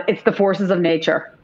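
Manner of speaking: ordinary delivery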